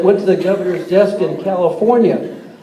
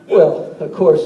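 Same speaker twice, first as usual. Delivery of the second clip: normal talking voice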